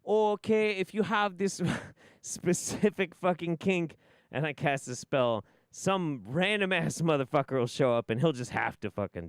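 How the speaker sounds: loud, high parody of a careless pitch; mock blithe. Mocking how the spell gets sold, loud blithe parody. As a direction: breeze through it loudly, hammed-up. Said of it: "You can't just be very blithely like".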